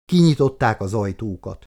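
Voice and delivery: usual speaking voice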